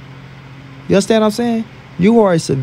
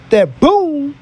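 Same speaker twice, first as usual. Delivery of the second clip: a high sudden boom, imitating the impact; punch sound effect; 8 semitones higher than usual. Miming a knockout punch.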